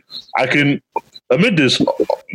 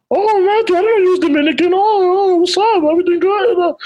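very high, squeaky imitation; mocking falsetto chumminess; mock fake-friendly coworkers. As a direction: gush in a high put-on voice, suddenly all buddies. Mimicking coworkers suddenly turning friendly.

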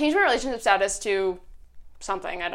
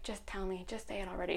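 very soft, lower mock-dramatic urging; dramatic hushed plea; 4 semitones lower, 17 dB quieter than usual. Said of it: "it was super dramatic".

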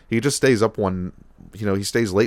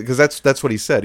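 usual speaking voice

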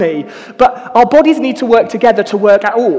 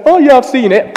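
confident dad; 5 semitones higher, 5 dB louder than usual. Retold or imitated. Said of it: "And he goes".

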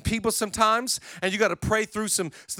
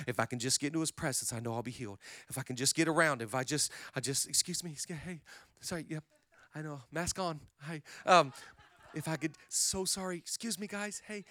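hushed pushing through; 5 semitones lower, 11 dB quieter, faster than usual. Retold or imitated. Woman whispering through a crowd.

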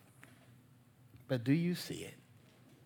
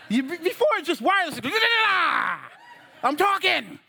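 high, loud comic exasperation; mock parental outburst; 8 semitones higher, 4 dB louder than usual. Parent snapping at a child wearing headphones, comic exasperation. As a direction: yell it as a joking, fed-up parent.

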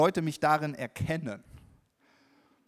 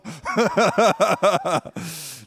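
loud laughter; 5 semitones higher, 13 dB louder than usual. Real, not acted. Preacher laughing at his joke about his son, loud laughter.

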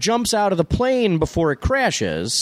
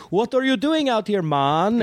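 higher-pitched mock Jamaican accent; attempted Jamaican accent; 7 semitones higher than usual. Mocking a survivor's impression of his fisherman rescuer, in an attempted Jamaican accent. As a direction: imitate someone trying a Jamaican accent. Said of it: "he tries to do the accent".

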